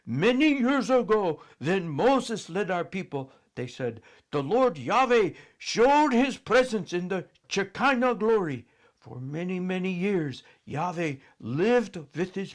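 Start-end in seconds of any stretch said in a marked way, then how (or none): 0.1-8.7 solemn storyteller. A narrator's reading. Priests telling the old story.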